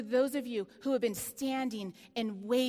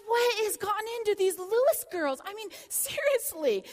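higher and louder, playfully exasperated; mock exasperated outcry; 8 semitones higher, 5 dB louder than usual. Telling the congregation about her daughters, mock exasperated outcry. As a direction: throw up your hands at the girls.

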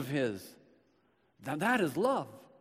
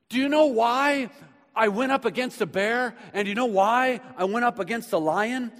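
higher and louder, a fired-up boast; bold challenge; 6 semitones higher, 4 dB louder than usual. Retold or imitated. Young David challenges King Saul, fired-up and defiant. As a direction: throw the questions at the king defiantly.

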